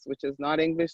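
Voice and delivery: usual speaking voice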